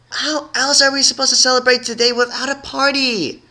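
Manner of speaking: high pouty whine; whiny. A narrator's reading. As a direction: complain like a sulky kid. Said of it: "complained with a pout".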